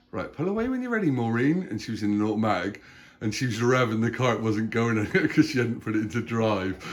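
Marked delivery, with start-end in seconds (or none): 0.1-1.7 high, fast instructor voice; driving instructor. Retold or imitated. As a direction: mimic a chirpy driving examiner. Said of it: "and he goes".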